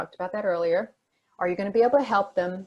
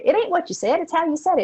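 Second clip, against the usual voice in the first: higher, louder mom voice, insisting; mock a mother setting her child straight; a mother repeating her point, higher and louder; 9 semitones higher, 6 dB louder, faster than usual. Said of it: "She said".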